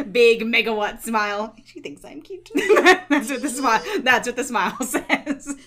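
giggling through the words; laughing. Real, not acted. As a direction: laugh while talking. Gushing about smile, laughing.

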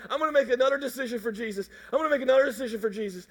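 rattled off fast; hurried. Retold or imitated. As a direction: rush the repeat mechanically. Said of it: "you're like".

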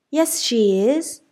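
high loud slow shout. A narrator's reading. Francis shouting from the kitchen, high slow yell. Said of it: "shouted Francis".

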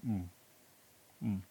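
swallowing a sip of wine; while sipping a drink. Real, not acted. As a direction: hum mid-sip of wine. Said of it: "Sorry, I'm drinking some wine".

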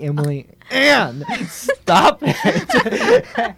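scolding through laughter; laughing mock scold. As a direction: break up laughing on the warning. Scolding sister while laughing.

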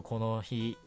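ordinary delivery

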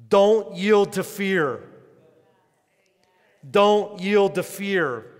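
loud, slow and firm, each word pressed; proclaimed command. Acted. Preacher booming Jesus' words to his congregation, loud and slow. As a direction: declare it over the crowd like a command.

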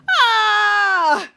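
long, loud, high "ah" falling away; hammed-up drawn-out cry; mock scream. Ray seeing his painting hit, imitated scream.

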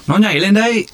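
high excited shout. A narrator's reading. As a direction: shout it out as the fish jumps. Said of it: "Tú Khỉ hét".

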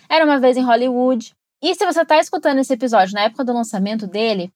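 normal talking voice

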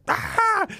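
very high mock scream; a hammed-up shriek. [name] screaming to scare the kids, high hammed shriek.

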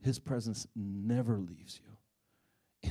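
slow, deliberate repeat of the key line; slow emphasis. Real, not acted. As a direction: lean on every word, slowly. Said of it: "I'm here to tell you".

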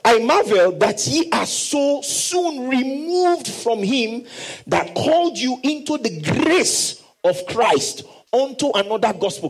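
slow, high, fervent scripture reading; preaching Paul's words. Acted. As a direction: proclaim it slowly like the apostle. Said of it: "Paul is saying".

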